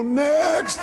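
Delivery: drawn-out high mock yell. Shown as a demonstration. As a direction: call it out long to passersby. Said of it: "Just yell 'next.'".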